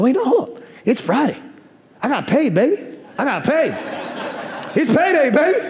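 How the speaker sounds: rising, laughing realisation of payday; sudden glee. Retold or imitated. Shopper at the register realising it's payday, gleeful laugh. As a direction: it just dawned on you, grin through it.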